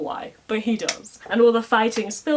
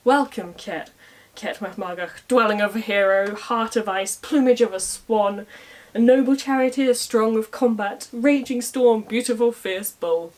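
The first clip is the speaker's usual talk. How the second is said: grand declamation; 3 semitones higher, 4 dB louder than usual. Retold or imitated. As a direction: build it like a bard's boast. Conall's poetic reply to Ket.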